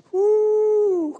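high loud joyful whoop; Southern food whoop; whoop of delight. Real, not acted. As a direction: let out a big happy woo.

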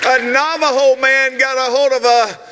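big, drawn-out pulpit announcement; shouted, stretched-out key words; excited announcement. Real, not acted. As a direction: proclaim it to a cheering crowd. Announces an engagement to cheering crowd.

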